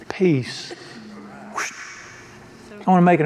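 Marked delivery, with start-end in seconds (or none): 0.1-0.7 one soft, settled word; calm command. Retold or imitated. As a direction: speak it like stilling the storm, softer. Jesus calms the storm.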